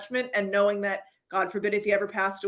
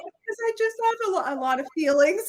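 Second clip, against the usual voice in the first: high, wobbly mock sob; mock crying; 10 semitones higher, 4 dB louder than usual. Wife explaining her tears to husband, high mock sob. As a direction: blurt it out through fake tears.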